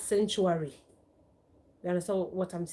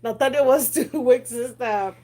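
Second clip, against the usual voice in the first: laughing; 8 dB louder than usual. Real, not acted. Laughing about son to viewers.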